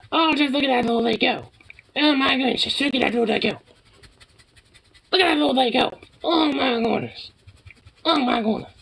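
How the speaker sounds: very high cooing voice for the dog. Real, not acted. High baby talk to dog.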